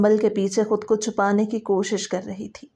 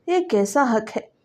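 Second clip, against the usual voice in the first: usual speaking voice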